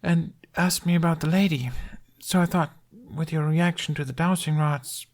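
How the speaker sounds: put-on high, drowsy kid voice; a sleepy boy reasoning aloud; lightly sleepy young boy; drowsy little boy. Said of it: "Jamie continued drowsily".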